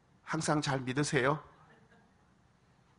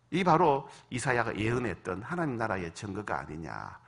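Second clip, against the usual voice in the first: ordinary talk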